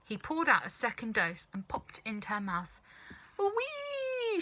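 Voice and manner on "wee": very high long squeal; grandma squealing as she shoots up; gleeful squeal. A narrator's reading. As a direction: squeal like on a ride. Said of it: "she screamed".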